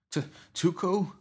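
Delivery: high, timid stammered question; scared kid's disbelieving question; frightened stammer. Acted. Terrified kid facing his attacker.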